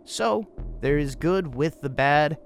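ordinary talk

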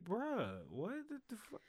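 incredulous. Retold or imitated. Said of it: "it really pissed me off".

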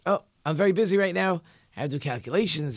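acted impatient dismissal; busy brush-off. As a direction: brush the children off, too busy to play. Father brushes off his children.

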